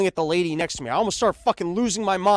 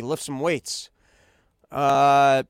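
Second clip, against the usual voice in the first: ordinary delivery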